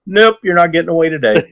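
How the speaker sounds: playful imitation of a friend catching a dog; Joe. Friend putting the runaway puppy back inside, lightly imitated. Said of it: "puts him in the house like".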